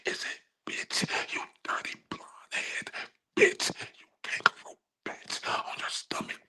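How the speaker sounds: hissed, voiceless insults; mock-menacing whisper; taunting whisper.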